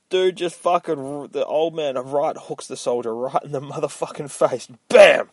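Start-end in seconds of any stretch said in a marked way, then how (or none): 4.9-5.3 loud shouted punch noise; punch sound effect. Retold or imitated. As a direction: yell the impact sound. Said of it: "sound effect in story".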